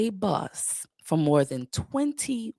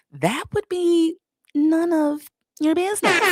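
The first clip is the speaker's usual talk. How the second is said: teasing refusal; 12 semitones higher, 4 dB louder than usual. Real, not acted. Telling curious viewers to back off, playfully teasing. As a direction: stretch it out high, building to a laugh.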